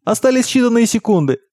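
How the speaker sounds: high-pitched urgent warning; yelled warning. A narrator's reading. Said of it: "заорал отец Бордену".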